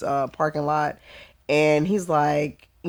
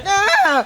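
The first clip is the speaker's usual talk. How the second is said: startled yelp; 13 semitones higher, 6 dB louder than usual. Retold or imitated. Cop barks, she yelps.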